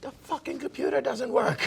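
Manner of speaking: high-pitched British chef rant, mimicked; mock outrage in a put-on chef voice; Gordon Ramsay. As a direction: imitate an irate celebrity chef. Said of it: "And he goes".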